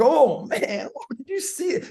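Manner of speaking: high-pitched amazed reaction; imitating hyped onlookers. Crew energizing each other in the cipher, hyped amazement. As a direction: let it leap up like a thrilled spectator.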